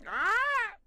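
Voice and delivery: high, loud squawk; parrot squawk. Acted. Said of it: "Can you do".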